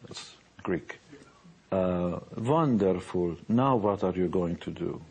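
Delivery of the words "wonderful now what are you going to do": put-on Hungarian mom's accent. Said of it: "in her broken English".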